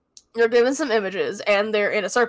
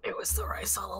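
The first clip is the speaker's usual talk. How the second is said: whisper; 12 dB quieter than usual. Pretend. Joking whisper about the culprit.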